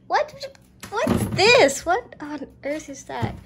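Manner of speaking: high, loud startled cry; shocked exclamation. Real, not acted. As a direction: react in sudden shock. Discovers something in fridge.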